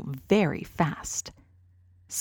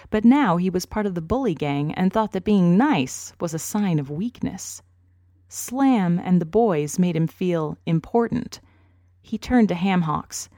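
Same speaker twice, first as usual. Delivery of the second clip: ordinary delivery, nothing marked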